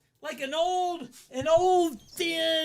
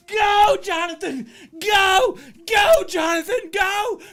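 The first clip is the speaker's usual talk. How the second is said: much higher, louder yelling "go, Jonathan"; cheering shout; 8 semitones higher, 9 dB louder than usual. Retold or imitated. Teen in the car cheering the jogger from the window, loud high shouts. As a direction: cheer him on at full volume.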